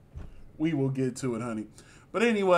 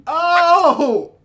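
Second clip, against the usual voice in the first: loud high shocked "Oh!"; shocked gasp; 12 semitones higher, 14 dB louder than usual. Real, not acted. Shocked reaction to the read.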